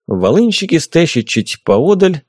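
usual speaking voice

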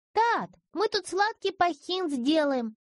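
put-on high, slow ringing child voice; ringing report. A narrator's reading. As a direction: report proudly like a little girl. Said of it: "звонко отрапортовала девочка". (звонкий детский доклад)

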